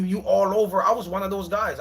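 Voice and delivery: normal talking voice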